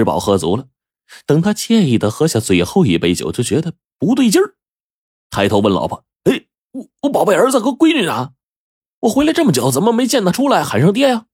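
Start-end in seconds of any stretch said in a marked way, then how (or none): 6.2-11.3 high-pitched, concerned querying; puzzled questioning. A narrator's reading. Drunk-full father asks wife about missing children, puzzled. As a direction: ask with rising bewilderment.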